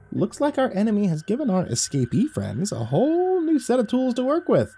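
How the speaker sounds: a small creature thinking aloud; thoughtful squirrel. Acted. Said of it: "Lucifer said thoughtfully".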